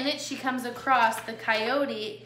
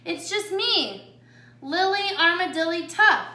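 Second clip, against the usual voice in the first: little armadillo sister; 7 semitones higher, 6 dB louder than usual. A narrator's reading. Smallest sister answers the voice in the tunnel, high-pitched. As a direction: answer up high, stretching the words.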